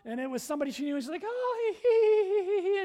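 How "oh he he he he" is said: high, loud fake giggle; imitated phone laughter. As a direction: put on a gushing laugh for the caller. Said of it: "and she's laughing".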